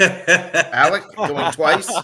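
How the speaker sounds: slow drawn-out auction call through laughter; laughing auctioneer call. Pretend.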